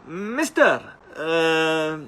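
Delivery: put-on high, loud stab at a Tanzanian accent; attempted Tanzanian accent. Tall local calls to the stuck rider, imitated high Tanzanian accent. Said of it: "I can't talk in their accent".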